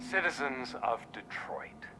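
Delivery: whispering. Acted. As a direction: address the city in a hush. Villain hijacks broadcast.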